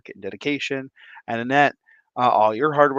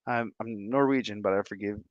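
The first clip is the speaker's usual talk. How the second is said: ordinary delivery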